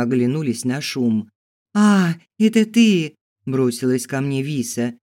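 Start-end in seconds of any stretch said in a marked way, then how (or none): 1.7-3.1 loud, high, drawn-out cry of recognition; excited greeting. A narrator's reading. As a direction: greet her with an eager, raised voice. Girl rushes to greet her. (радостно-взволнованный возглас)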